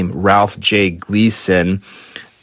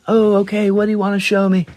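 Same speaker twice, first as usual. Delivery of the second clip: lightly higher, curious star voice; curious Mick; 8 semitones higher, faster than usual. Retold or imitated. Mick answering the old lady, light and curious. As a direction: play along, mildly curious.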